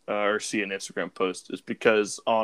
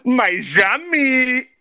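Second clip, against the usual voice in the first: high, loud indignant cry; indignant shout; 9 dB louder than usual. Real, not acted. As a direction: yell the team name in disbelief. Outraged answer to "who?.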